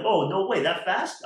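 high and loud, caught off guard; surprised delight. Retold or imitated. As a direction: gasp it out, pleasantly shocked. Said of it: "I'm like".

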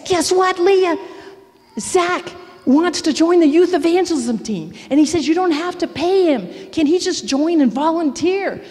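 excited news. Retold or imitated. Excited call to friend about son.

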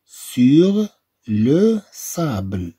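very slow, each word spelled out; slow model phrase. Shown as a demonstration. Teacher reading a French phrase to pupils, very slow. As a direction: stretch "sur le sable" out slowly for the class. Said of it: "هنا عندنا".

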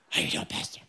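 unvoiced low rasp; tough Batman-type voice. Churchgoer greeting the pastor in a Batman rasp. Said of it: "they talk like that".

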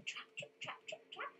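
quiet "trip, trap" hoof steps, rising at the end; footstep sounds. Shown as a demonstration. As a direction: tiptoe the hooves softly. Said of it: "Can you go trip".

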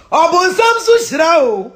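loud, drawn-out proclamation. Real, not acted. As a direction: raise the voice and drive it home. Higher, louder repeat.

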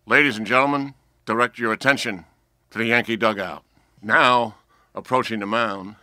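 loud, measured stadium announcer with pauses; Bob Shepard imitation. Boy on a chair performing for his family, imitating a stadium announcer. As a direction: boom it out like an old ballpark PA announcer. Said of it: "I used to do imitations of him".

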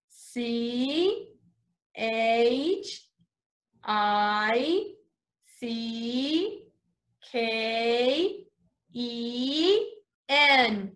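each letter on its own with pauses between. Shown as a demonstration. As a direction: spell it out slowly for the class. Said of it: "Let's spell chicken".

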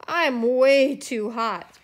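loud, slow, drawn-out protest; overheated complaint. A narrator's reading. Snowman bundled in scarf and coat, loud overheated complaint. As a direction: stretch it out loudly, overheated.

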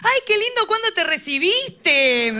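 grandma cheerfully misunderstanding, high and loud; delighted grandma. Retold or imitated. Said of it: "soy lesbiana". (abuela encantada, voz aguda y fuerte)

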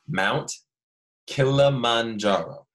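Kilimanjaro said slowly and clearly, each syllable apart; slow it down, Mount Kilimanjaro. Shown as a demonstration. Teacher correcting class, saying it slowly. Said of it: "you said that too fast".

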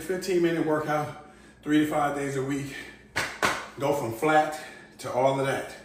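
ordinary delivery

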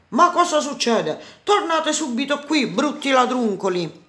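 outraged storybook shout; angry yelling. A narrator's reading. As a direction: yell after the escaping thieves. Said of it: "non restò che urlare".

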